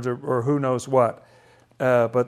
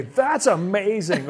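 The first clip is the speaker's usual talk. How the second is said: higher, drawn-out exclamation; amazed; 5 semitones higher, slower than usual. Acted. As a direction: play an astonished onlooker. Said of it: "I would say".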